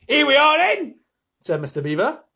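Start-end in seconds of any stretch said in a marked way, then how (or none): 0.1-1.0 loud, high, hearty Cockney call. A narrator's reading. Mister Beaver announcing their arrival, loud Cockney. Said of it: "I'm allowed to do Cockney for him".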